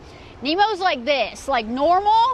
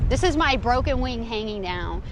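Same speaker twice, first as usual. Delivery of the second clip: ordinary talk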